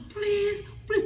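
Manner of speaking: high, thin retold plea; pleading. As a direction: mock-desperate begging at the door. Begging at closed plane door.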